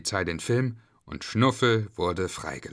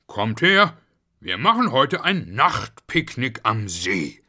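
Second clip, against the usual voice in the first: calling; 5 dB louder, slower than usual. Acted. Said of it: "rief Schnuffel".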